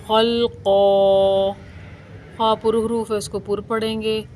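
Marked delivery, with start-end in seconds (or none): 0.1-1.5 खलक़ो slow and louder, final vowel held one alif; pausal long ending. Shown as a demonstration. Teacher shows the pausal form, long held ending. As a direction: stretch the end of खलक़ो, full and held. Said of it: "वक्त की सूरत में".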